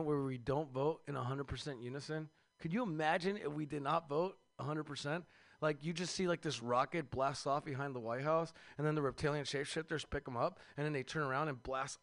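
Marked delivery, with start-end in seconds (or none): none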